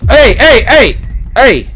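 high, loud repeated heys; alarmed shouting. Acted. Gamer fending off partner, high alarmed shouts. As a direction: yell sharply to halt someone.

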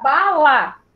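"bala" drawn out as ba, la; syllable by syllable. Shown as a demonstration. Teacher showing the class the word formed, "bala" syllable by syllable. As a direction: stretch both syllables of "bala". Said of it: "a sílaba lá".

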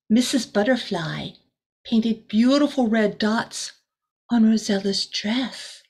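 ordinary delivery, nothing marked

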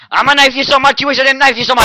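loud high threat; shouting. Acted. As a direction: scream it at them.

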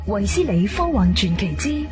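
ordinary delivery

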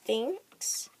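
ordinary delivery

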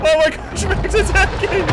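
thrilled and alarmed at once; excited shriek. Real, not acted. Meg attacks the ship.

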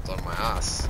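normal talking voice

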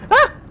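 short, very high loud yelp; startled yelp. Real, not acted. Player nearly caught while running, startled yelp. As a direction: yelp in sudden fright.

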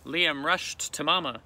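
ordinary delivery

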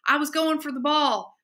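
high, desperate yell; screaming protest. A narrator's reading. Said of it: "jumped up screaming".

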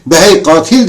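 acted outburst of outrage; retold angry shout. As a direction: hurl it at him as a reenacted yell. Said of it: "avazım çıktığı kadar bağırdım üstüne".

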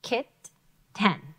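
Kit, ten said as two separate beats; split syllables. Shown as a demonstration. Teacher splitting kitten into syllables. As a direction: give Kit and ten each a beat. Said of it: "two closed syllables".

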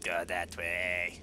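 low, very slow drawn-out character voice; playful slow, deep direction-giving; put-on guide voice. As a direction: draw each word out low and soft.

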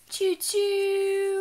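sing-song high choo-choo; high train whistle. Pretend. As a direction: toot it like a toy train. Spotting a train in book.

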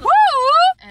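high, loud cheer; excited whoop. Real, not acted. Hyping herself up.

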